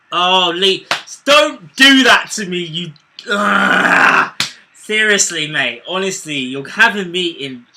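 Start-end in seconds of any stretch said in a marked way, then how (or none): 0.1-2.9 loud pleading groan; exasperated loud plea. Real, not acted. Mate teasing a goal call. 3.3-4.4 loud frustrated groan. Real, not acted. Groan at mate's tease.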